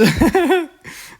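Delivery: high, loud burst of laughter. Real, not acted. Tutor cracking up at her own outburst, high laugh.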